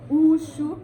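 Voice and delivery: "Ushu" with an oo opening; Ondo pronunciation. Shown as a demonstration. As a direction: sound the U of "Ushu" as oo. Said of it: "The U is pronounced as U".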